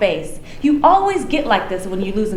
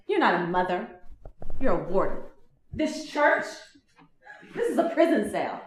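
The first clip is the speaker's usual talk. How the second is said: bitter hurt; 2 semitones lower, 6 dB quieter than usual. Acted. Bitter hurt reply, quieter.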